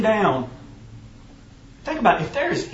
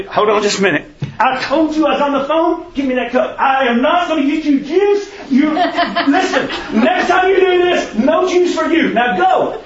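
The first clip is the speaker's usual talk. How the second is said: exasperated scolding; 8 semitones higher, 5 dB louder than usual. Retold or imitated. Parent scolding child for juice.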